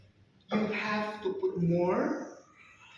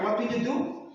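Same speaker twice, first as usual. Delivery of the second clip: ordinary talk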